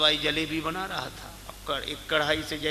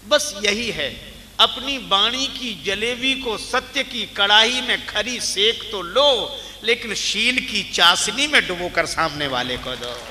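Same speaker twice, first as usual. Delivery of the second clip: emphatic preaching; 5 semitones higher, 8 dB louder than usual. Real, not acted. Preacher urging listeners to dip truth in kindness, emphatic preaching. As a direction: drive the lesson home at full voice. Drives home the moral emphatically.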